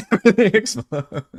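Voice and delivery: laughing out the words; giggling through it. Real, not acted. Laughing at the plan.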